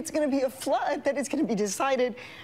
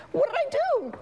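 put-on much higher, pleading kid's voice; imitating a hurt kid. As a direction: voice the boy's bewildered hurt, high. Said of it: "'cause he's like".

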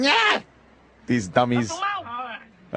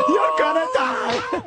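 high, drawn-out mock yell; high-pitched mock menace; mimicked movie threat; 12 semitones higher than usual. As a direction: stretch it out in a raised imitation of a death threat. Mimics the film character's threat.

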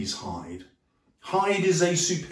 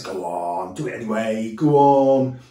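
low coaxing Satan; 4 semitones lower than usual. Retold or imitated. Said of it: "Satan, in the form of the serpent, says".

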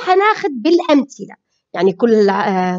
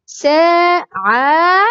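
ساعة drawn out as saa, aa, tun; slow syllable-by-syllable; 2 semitones higher, much slower than usual. Shown as a demonstration. Teacher breaks down the example word, slow syllables. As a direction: stretch each syllable of ساعة. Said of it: "سين جاءت في أول الكلمة".

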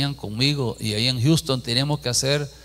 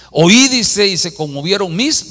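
proclaimed loud; 6 semitones higher, 8 dB louder than usual. Acted. Preacher proclaims scripture verse.

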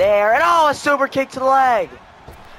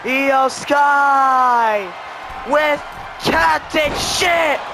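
frantic near-fall call; 3 semitones higher than usual. Real, not acted. Commentator screaming as Liv kicks out, frantic. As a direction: shout the kickout like you can't believe it. Finisher and pin attempt.

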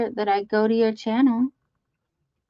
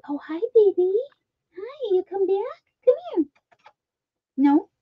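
much higher cooing call to the pet; pet-talk greeting; 10 semitones higher than usual. Real, not acted. Owner calling her returning pet, high sweet coaxing voice. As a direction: coax the little pet over, sweetly and high.

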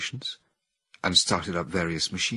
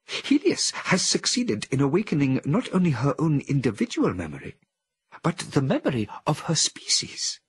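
higher, eager voice; excited ape scientist; 8 semitones higher, 3 dB louder than usual. A narrator's reading. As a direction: rush to share the discovery. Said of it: "betrayed the same excitement".

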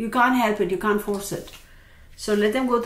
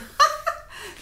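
high laugh. Real, not acted. Laughing at the cards.

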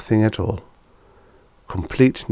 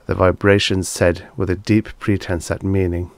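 plain reading voice

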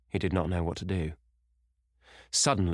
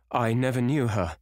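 panicky, higher-pitched denial; flustered and pleading; stammered; 6 semitones higher, 4 dB louder than usual. A narrator's reading. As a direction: protest in a frightened, raised voice. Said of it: "he stammered".

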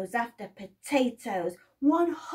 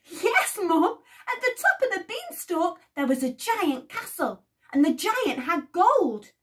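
excited report; 7 semitones higher, 5 dB louder than usual. Acted. Jack excitedly reporting to mother.

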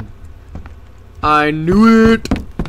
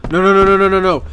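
ordinary delivery

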